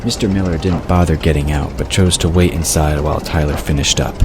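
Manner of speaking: ordinary talk, nothing marked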